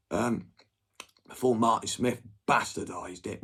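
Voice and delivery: ordinary talk, nothing marked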